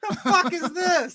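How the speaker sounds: aghast at the ridiculous gun; incredulous outburst. Retold or imitated. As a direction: react in disbelief at a toy gun. Sees the space gun.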